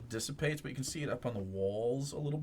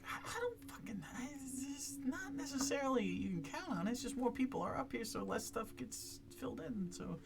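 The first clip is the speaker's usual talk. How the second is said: much higher put-on character voice; NPC guide voice; 11 semitones higher, 4 dB quieter than usual. NPC guide warning the party, high character voice. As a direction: explain the danger in a high character voice.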